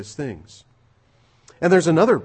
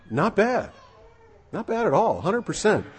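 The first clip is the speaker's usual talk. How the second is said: impressed; 6 semitones higher than usual. Real, not acted. Preacher admiring the profit.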